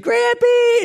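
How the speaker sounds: put-on high, loud little-girl squeal of "Grampy"; toddler calling out. Grandfather voicing his granddaughter running in, excited toddler squeal.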